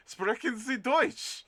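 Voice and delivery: high, loud, slow mock German accent. Pretending to speak German to chat, mock German accent. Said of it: "pretend we are".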